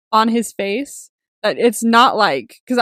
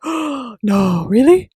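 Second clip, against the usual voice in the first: surprised gasp; 2 semitones higher, 8 dB louder, much slower than usual. Retold or imitated. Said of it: "I was like".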